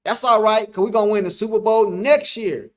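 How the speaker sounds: loud, hammed-up fan bravado; mock-confident fan hype; delusional Cowboy fan. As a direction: play the deluded die-hard supporter. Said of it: "be a delusional Cowboy fan".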